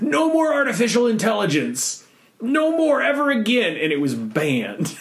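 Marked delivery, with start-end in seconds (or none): 0.0-3.8 high, emphatic mock proclamation; mock-official outburst, pitched up; decreeing government. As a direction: lay down a sweeping ban, comically overdone. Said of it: "the government went".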